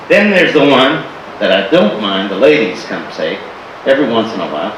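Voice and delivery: ordinary talk, nothing marked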